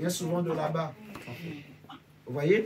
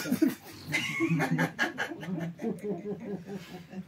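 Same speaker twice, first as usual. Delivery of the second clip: laughing; 3 semitones higher than usual. Real, not acted. Laughs at listener's quip.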